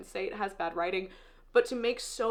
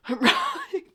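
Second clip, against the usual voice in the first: a higher, louder laugh cracking through the tears; teary laugh; 13 semitones higher, 6 dB louder than usual. Real, not acted. Reader pulling herself together, teary laugh. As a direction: laugh it off while still crying. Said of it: "I'm gonna rally".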